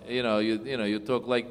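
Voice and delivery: ordinary delivery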